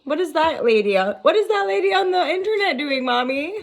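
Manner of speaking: mock kid asking Mommy, pitch leaping up; puzzled child viewer. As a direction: play a bewildered child watching, ending near laughter. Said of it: "I think some of you are like".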